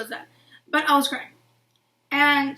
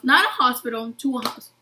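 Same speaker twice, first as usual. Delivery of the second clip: ordinary delivery, nothing marked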